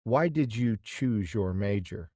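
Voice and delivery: ordinary delivery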